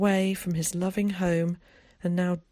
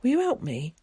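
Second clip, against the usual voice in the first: timid, high pleading question; hesitant high child's voice; 7 semitones higher than usual. A narrator's reading. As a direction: ask for help shyly, pitched up. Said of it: "he asked timidly".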